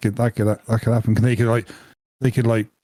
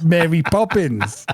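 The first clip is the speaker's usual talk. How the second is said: laughing; 3 semitones higher, slower than usual. Real, not acted. Laughing at bibbidi bobbidi.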